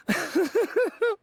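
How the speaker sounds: short, high embarrassed chuckle; a bashful giggle at his own eagerness; sheepish laugh. Acted. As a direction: laugh it off awkwardly, caught out. Embarrassed laugh after promise.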